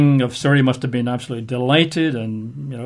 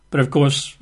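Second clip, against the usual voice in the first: usual speaking voice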